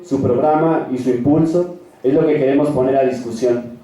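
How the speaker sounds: ordinary delivery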